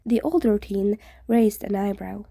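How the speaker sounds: plain reading voice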